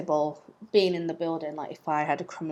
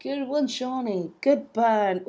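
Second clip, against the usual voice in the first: wry mock praise to herself, higher; self-mocking; 4 semitones higher, slower than usual. Real, not acted. Vlogger ribbing herself over her own joke: wry. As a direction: tease yourself after the joke. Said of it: "laughs at own joke".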